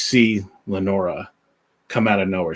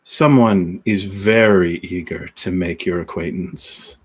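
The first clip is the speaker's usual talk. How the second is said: [name]'s voice; 5 semitones lower than usual. Acted. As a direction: lower and slower, a sinister captor. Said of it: "you can hear [name]'s voice".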